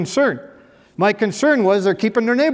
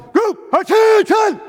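barked command; 12 semitones higher, much slower than usual. Retold or imitated. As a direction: bellow it like an army captain calling a room to attention. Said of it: "he would step forward and go".

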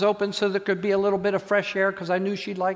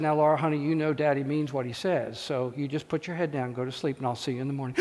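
soft settling father; 6 semitones lower, 6 dB quieter than usual. Retold or imitated. Father softly settling child.

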